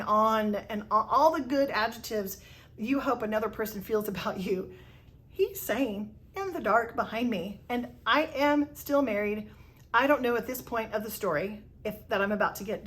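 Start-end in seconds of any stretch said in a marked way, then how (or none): none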